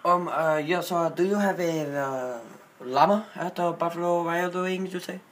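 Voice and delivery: put-on halting Indian-accented English. Prank caller asking a wings place for llama, in Indian accent.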